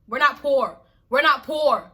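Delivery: firmly stamp it out; emphatic repeated denial; insistent. Real, not acted.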